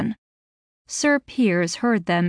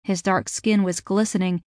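ordinary delivery, nothing marked

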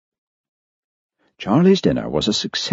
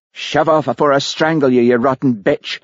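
raised pitch, mildly shouted temper; restrained angry yell; 8 semitones higher than usual. A narrator's reading. Charlie losing his temper at Olivia, restrained angry yell. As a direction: threaten hotly without full volume. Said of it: "he yelled".

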